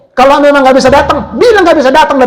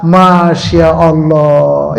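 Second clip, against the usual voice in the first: awed masya Allah; 7 semitones lower, much slower than usual. Shown as a demonstration. Preacher marveling at a beautiful view, slow and lower. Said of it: "tekanannya beda".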